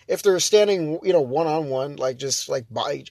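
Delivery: ordinary talk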